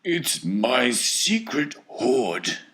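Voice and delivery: ordinary delivery, nothing marked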